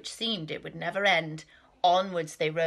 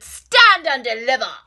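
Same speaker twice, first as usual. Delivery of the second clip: loud, high bandit roar; thundering rat; 3 semitones higher, 11 dB louder than usual. Acted. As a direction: roar it to scare the squirrel. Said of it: "who thundered".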